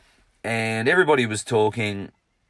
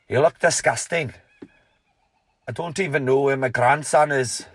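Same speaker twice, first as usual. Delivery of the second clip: louder, higher stern Scottish grandfather voice; Scottish grandfather rebuke; 5 semitones higher, 5 dB louder than usual. Retold or imitated. Grandfather scolding grandson at the dinner table, stern Scottish accent. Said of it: "Notice I've ejected the Scottish accent".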